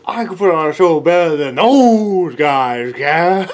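musician bragging at the crowd, slow loud shout; slow boastful yell. Acted. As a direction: bellow it slowly like a wounded ego. Performer boasts, yelling.

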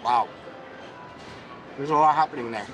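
talking with mouth full; muffled words mid-bite; chewing. Real, not acted. Seen 2: Bites burger at -4; mouth moves while chewing, looking down.

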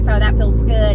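ordinary delivery

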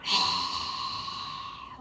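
long, forceful dragon exhale; big audible whooshing exhale; fire breath out. Shown as a demonstration. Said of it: "Fire breath out".

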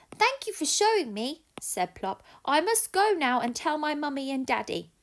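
high little owl voice; grateful, eager owlet; baby owl. Acted. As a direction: owlet thanking the cat. Plop the owl speaks.

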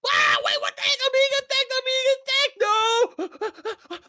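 high, loud panicked cries; panicked yelling. Real, not acted. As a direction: yell in panic while attacked. Attacked by sea monster.

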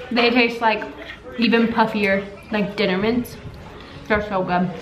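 eating while speaking; talking while chewing. Real, not acted. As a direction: mouth partly full. Seen 0: Pink-shirt speaker chews and bites meringue between phrases while talking.

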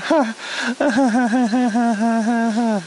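long rapid-fire forced cackle; drawn-out stuttering mock laughter. Reviewer jeers at Squidward's misfortune, mock laugh.

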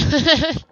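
loud high laugh of relief; real laugh; burst of laughter. Real, not acted. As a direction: break out laughing at the kill. Laughing after killing creature.

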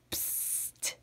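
hushed, breathy psst to draw someone near; secret psst. Acted. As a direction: whisper it like sharing a secret. Calling reader closer secretly.

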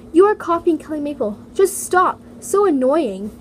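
reading the annoying comment out loudly; a loud, jeering read of a rude comment; loud mock comment read.

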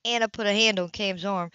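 plain reading voice

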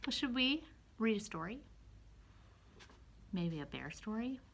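soft secret whisper. Real, not acted. Whispered aside to children.

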